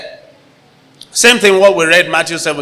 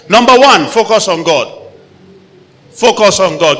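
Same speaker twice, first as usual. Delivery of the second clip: emphatic call; 4 semitones higher, 4 dB louder than usual. Real, not acted. Preacher calls out point.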